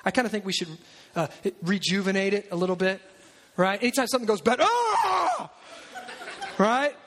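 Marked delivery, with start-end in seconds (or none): 4.6-5.5 short laughing shout, pitched up; mock anguished yell. Preacher mimics tearing his clothes, laughing yell. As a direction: let out a joking cry of frustration.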